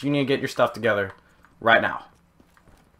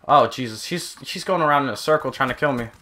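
normal talking voice

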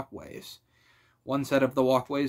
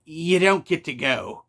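drawn-out groan of giving in; slow, louder, reluctant admission; 3 semitones higher, 6 dB louder, slower than usual. A narrator's reading. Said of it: "Gary groaned c-, in capitulation".

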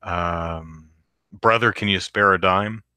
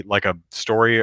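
usual speaking voice

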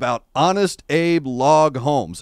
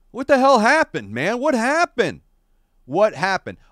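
mock outrage; 7 semitones higher than usual.

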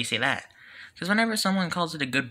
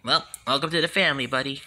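ordinary talk, nothing marked

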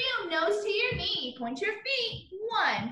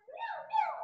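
tiny, much softer and higher kitten mew; quiet little kitty meow; 12 semitones higher, 7 dB quieter than usual. Pretend. Imitating cat in song.

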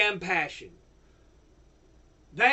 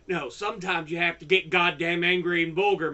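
ordinary delivery

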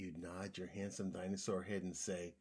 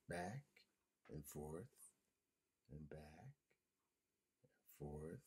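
low, hushed swinging rhythm with pauses; fading softly; 6 semitones lower, 13 dB quieter, slower than usual. A narrator's reading. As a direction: let it fade away to a low whisper. Story fades out on swings.

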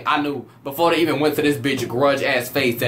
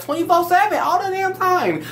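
pitched way up, dragging out the complaint; exasperated high whine; 13 semitones higher than usual. Real, not acted. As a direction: whine it like you're fed up for good. Ranting about hating her.

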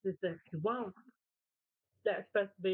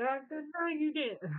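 high, indignant mock telling-off; retold scolding; 6 semitones higher than usual. Owner telling off her dog for eating only the meat, mock scolding. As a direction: act out scolding a naughty pet.

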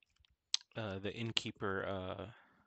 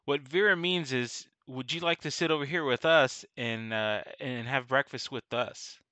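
raised-voice invitation across the room; louder, projected friendly offer; calling out; 4 semitones higher, 8 dB louder than usual. Acted. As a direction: call over to someone at another table. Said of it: "Xana calls out".

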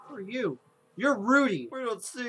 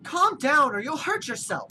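higher, raised scolding mom voice; worried mother; 7 semitones higher, 4 dB louder than usual. Acted. Mother scolding her rambunctious child, worried and raised. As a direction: call out like a fretting mother. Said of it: "Worried Mother Helen".

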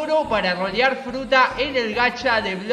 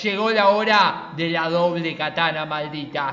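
low, very slow, solemn proclamation; deep, ominous delivery with weighty pauses; epic dramatic narration; 6 semitones lower, slower than usual. Acted. Gamer unveiling the cursed katana against K-Queen, low ominous proclamation.

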